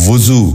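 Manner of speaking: وضو said as "vu-zoo", short u after v; correct pronunciation. Shown as a demonstration. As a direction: open وضو with "vu". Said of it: "درست تلفظ ہے".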